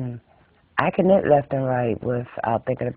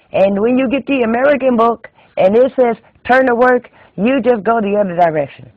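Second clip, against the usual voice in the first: put-on high, loud scolding old-school knitter; bossy exclamation at a pupil's mistake; scolding Swedish teacher; 7 semitones higher, 7 dB louder than usual. Swedish teacher lecturing on proper knitting.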